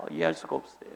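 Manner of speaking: breathy whispered repeat; whispered aside. Real, not acted. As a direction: mutter it under your breath in disbelief. Exasperated aside. (혼잣말로 속삭이듯)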